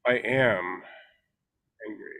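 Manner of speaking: suspended pause, hushed "angry"; long pause before angry. Shown as a demonstration.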